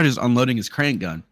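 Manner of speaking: ordinary talk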